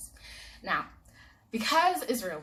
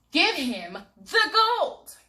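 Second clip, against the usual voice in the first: slow, loud, higher command; insistent shout; 9 semitones higher, 12 dB louder, much slower than usual. Acted. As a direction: order it, drawn out and firm. Israel insists loudly.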